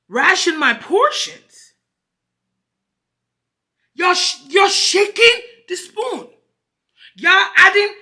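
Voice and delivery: high, loud, slow disbelief; rising up in frustration; exasperated outburst. Real, not acted. To the staff, loud and exasperated. Ranting about shaking the spoon.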